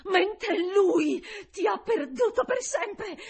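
high, slow, loud cry; impassioned, screamed defiance; defiant shout. A narrator's reading. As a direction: hurl it at the foul being, drawn out. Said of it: "gridai rivolta all'essere immondo".

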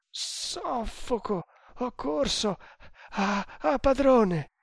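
high, panting gasps, words broken apart; choking, out of breath. A narrator's reading. Exhausted runner to his master, choking and breathless. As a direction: gasp it out, rising to a cry. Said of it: "ha galoppato come un cavallo e soffoca".

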